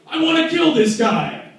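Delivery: mock wrestler shout; high, loud outburst. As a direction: yell it high and slow. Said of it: "it was like".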